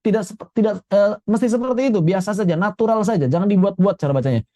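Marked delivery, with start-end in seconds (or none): none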